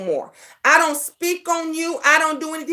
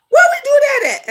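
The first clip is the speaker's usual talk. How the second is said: higher and louder, calling it out; high, loud challenge; 11 semitones higher, 5 dB louder than usual. Acted. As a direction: call it out like a dare to the chat. Repeated rhetorical challenge.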